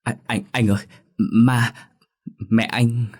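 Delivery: terrified stutter, bursting out in broken pieces; panicked stammering. A narrator's reading. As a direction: stammer it out in fright, word by word. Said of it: "miệng lắp bắp".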